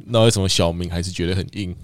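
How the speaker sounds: ordinary talk, nothing marked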